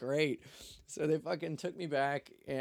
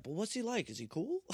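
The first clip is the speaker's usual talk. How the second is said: higher-pitched acted question; mock eager question; 9 semitones higher than usual. Cops asking the storyteller about someone, mock eager question. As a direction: ask it up high, imitating the cops.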